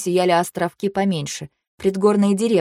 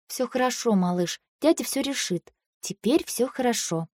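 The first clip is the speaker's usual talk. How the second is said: soothing; 4 semitones higher, 6 dB quieter than usual. Acted. Older sister soothes crying boy.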